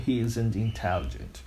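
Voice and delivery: "isn't" run into "intelligent"; "isn't" as one reduced word, n't for NOT; casual spoken contraction on "isn't"; contracted negative. Shown as a demonstration. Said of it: "você vai ouvir muito".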